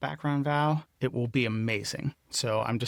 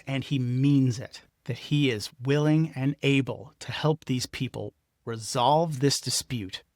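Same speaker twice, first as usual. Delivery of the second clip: ordinary talk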